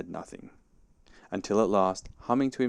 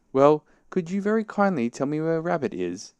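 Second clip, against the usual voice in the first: high, louder courteous request; polite calling; 9 semitones higher, 6 dB louder than usual. A narrator's reading. Pooh asking where Rabbit is, polite calling. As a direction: ask politely in a high, raised voice.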